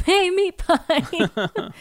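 high, slow, drawn-out catcall; mock call-out. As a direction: stretch it out like hailing someone. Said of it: "Glad he doesn't yell that".

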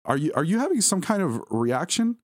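high, panicky questioning; frightened, pitch way up; stuttering panic. A narrator's reading. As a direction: stammer out an alarmed question. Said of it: "I stuttered, now hyperventilating".

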